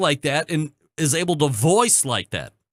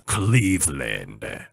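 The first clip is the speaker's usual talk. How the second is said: much lower, slow, drawn-out announcer imitation; imitating the announcer; 12 semitones lower, much slower than usual. Shown as a demonstration. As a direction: stretch out Cleveland low and slow like a radio voice guy. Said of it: "It's not even the same".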